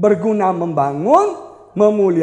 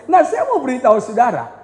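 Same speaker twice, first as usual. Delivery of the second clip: normal talking voice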